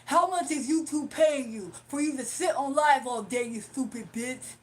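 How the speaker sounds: loud, labored heckler voice slowing at the insult; mocking caller impression. Streamer imitates the caller grilling her about YouTube pay, mocking. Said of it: "That's exactly how he sound".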